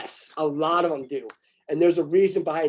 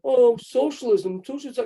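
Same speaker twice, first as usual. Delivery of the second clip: high, whining mock of complainers; sarcastic imitation of whiners; mocking whiny cry; 6 semitones higher, 3 dB louder, slower than usual. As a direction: parody people crying about socialism. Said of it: "people cry and bitch".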